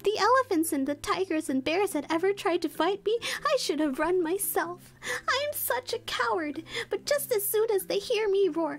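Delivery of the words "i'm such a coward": high, slow self-pitying wail; lion bemoaning his cowardice; slow, high sorry-for-himself lion; sad lion. Acted. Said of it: "continued the lion sadly".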